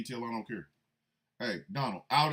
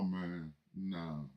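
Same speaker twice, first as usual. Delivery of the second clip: usual speaking voice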